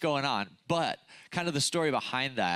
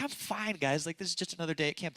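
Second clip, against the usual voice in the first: high, quick and breezy; airy I'm-fine dismissal, higher and fast; breezy brush-off; 5 semitones higher, 3 dB quieter than usual. Retold or imitated. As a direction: laugh it off like nothing happened. Brushing off friends' concern.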